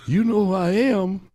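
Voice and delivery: high, loud, slow question; Muhammad Ali. Retold or imitated. Champ asking the old man, high loud surprise. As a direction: call it out in eager disbelief. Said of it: "So Ali".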